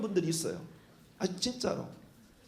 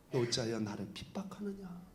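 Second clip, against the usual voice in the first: low, much softer gentle question; gentle Jesus; 8 semitones lower, 13 dB quieter than usual. Retold or imitated. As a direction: ask it softly, without blame. Jesus speaks to Paul. (바울에게 묻는 예수님, 낮고 부드럽게)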